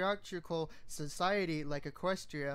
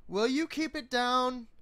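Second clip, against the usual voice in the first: higher, louder shout through the wall; annoyed yell; 7 semitones higher, 5 dB louder than usual. Acted. Stallion from the next compartment, louder annoyed yell. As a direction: yell at noisy neighbours. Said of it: "a male voice yelled".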